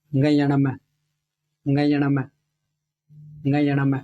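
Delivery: गयण में repeated, each ङ nasal and clear; recited rule. Shown as a demonstration. Teacher to students, chanting the Sanskrit sutra. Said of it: "ऐसे बोला जाता है".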